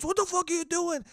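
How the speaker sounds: angry dad. Retold or imitated. Imitating his dad catching him blasting music. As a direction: ham up the dad storming in.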